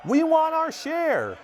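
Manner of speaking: high, loud furious crowd yell, slow and hammered; angry shout. Acted. Angry farmers demanding their share: high furious shout. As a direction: bellow it as an angry mob. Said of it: "They shouted".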